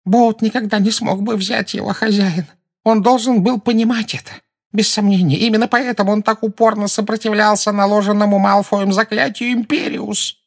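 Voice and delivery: high, nasal, begging whine; nasal pleading servant. A narrator's reading. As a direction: grovel through the nose. Servant Rookwood explains. (гнусавый умоляющий голос)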